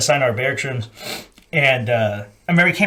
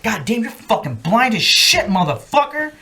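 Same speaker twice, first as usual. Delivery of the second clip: imitated incredulous sergeant; 7 semitones higher than usual. Sergeant testing the marine's glasses, mock astonishment. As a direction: stretch it out in disbelief. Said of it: "He's like".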